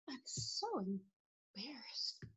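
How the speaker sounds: tiny, slow embarrassed whisper; hushed, drawn-out embarrassment. Shown as a demonstration. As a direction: whisper it as if shrinking away. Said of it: "Those are emotions".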